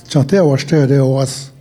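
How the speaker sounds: ordinary talk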